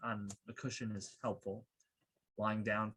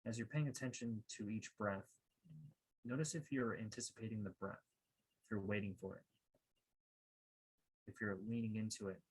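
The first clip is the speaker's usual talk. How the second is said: calm, measured meditation guide, softer than a welcome; guided meditation; 6 dB quieter than usual. Acted. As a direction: guide listeners gently inward, leaving long pauses.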